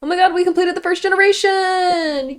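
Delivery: shrieking with joy, high and loud; giddy relief. Real, not acted. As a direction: cheer like you finally won. Finishing the first generation.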